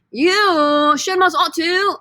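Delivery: loud, high British accent. Shown as a demonstration. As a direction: belt it out in a put-on British accent. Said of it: "with a British accent".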